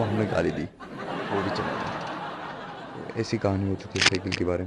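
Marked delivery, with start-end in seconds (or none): 1.3-3.1 very slow giggly whisper, barely voiced; whispered laugh. Real, not acted. A boy tells friends of the bully leaving, laughing in a whisper. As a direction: laugh the words out under your breath.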